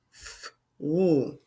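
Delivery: "f" and "l" as separate held sounds; letter sounds. Shown as a demonstration. Teacher splitting the first blend, sounds held apart. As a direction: draw out "f", then "l", as pure sounds. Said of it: "I hear f and a l".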